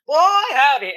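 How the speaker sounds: loud, high country whoop. Acted. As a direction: whoop it like a cowboy. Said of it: "and he goes".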